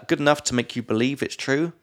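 ordinary talk

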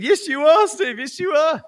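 loud, high evangelical cheering. Retold or imitated. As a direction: preach it back to him like a revival. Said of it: "I was getting evangelical at this point".

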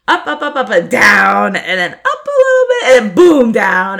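rising and falling with the words; animated. Shown as a demonstration.

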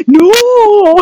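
long high drawn-out "no"; loud wail of protest, hammed up; mock panicked yell. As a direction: yell it like a freaked-out husband. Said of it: "He's, like, yelling".

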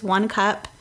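ordinary delivery

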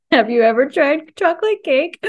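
high laughing question. Real, not acted. Host laughs, asking if friend tried chocolate cake. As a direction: tease through a laugh.

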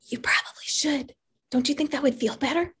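breathy whisper of the inner voice; a tiny voice urging softly. Retold or imitated. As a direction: whisper it gently, coaxing. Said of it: "the one that whispers".

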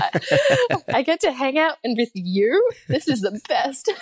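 high, giddy gushing through laughter; re-enacted starstruck excitement, laughing; fangirl moment. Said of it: "I had a little fangirl moment".

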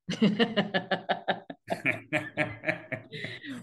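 long, high laugh at his own joke; real laugh. Real, not acted. After joking he stopped doing crowns, laughing. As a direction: laugh it off, falling away.

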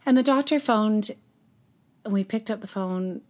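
ordinary delivery

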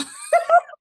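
loud squealing laugh; high burst of laughter. Real, not acted. Laughing at own story.

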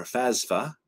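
"fasfa" with s and f swapped; mispronunciation. Shown as a demonstration. Host mocking how some people say fafsa, mispronounced. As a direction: say "fasfa", putting the s before the f. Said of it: "Some people pronounce it those ways".

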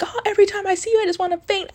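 very high, swooning falsetto; hammed-up high-pitched swoon; mock swooning fan. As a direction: imitate a fan pretending to faint. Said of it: "she just kept pretending to faint".

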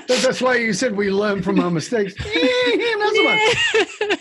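laughing through the words, real amusement; cracking up at herself. Real, not acted. Laughing as she admits to the host that she didn't learn. Laughing at own repeated falls.

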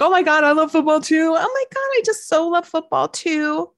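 high, breathless, over-the-top fangirl squeal; fake gushing enthusiasm. Pretending to love football to a new boyfriend, high gushing squeal. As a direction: gush to a new date as if football is your passion.

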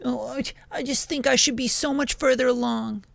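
high, mock-whiny put-on voice; mocking a whiny beginner. Imitating impatient producers in their twenties, high and whiny. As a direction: make fun of the impatient young artist.

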